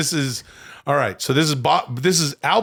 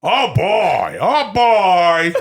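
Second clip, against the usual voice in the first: loud, higher mock bellow; big drawn-out actor impression; De Niro doing Al Pacino; 7 semitones higher, 5 dB louder, much slower than usual. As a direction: belt out the catchphrase as a hammy impression. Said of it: "My friend Al Pacino".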